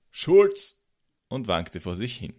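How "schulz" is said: put-on high, loud drunken call; loud shout. A narrator's reading. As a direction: blurt it out at full voice. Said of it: "rief laut".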